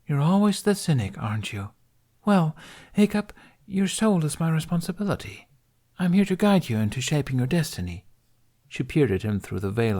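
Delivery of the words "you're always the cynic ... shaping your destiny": lightly voiced maiden, higher, teasing; a hint of a playful guardian chiding him; gently mocking female voice, higher; teasing woman. A narrator's reading. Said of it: "The woman tisked".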